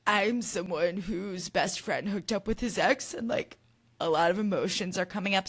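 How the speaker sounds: half-hearted crying voice; mock sobbing. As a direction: quote him as if choking through sobs. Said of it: "Jesse is sobbing".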